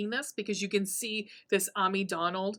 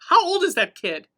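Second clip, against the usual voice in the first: higher, louder incredulous question; shocked disbelief; 9 semitones higher, 8 dB louder than usual. Retold or imitated. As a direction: blurt it out in disbelief. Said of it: "even Gemma's like".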